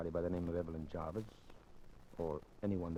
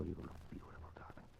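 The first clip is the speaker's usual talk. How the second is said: hushed, adoring whisper; whispered tender praise; admiring whisper; 4 semitones lower, 14 dB quieter than usual. Acted. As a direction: breathe the compliment softly. Admiring her before they flee.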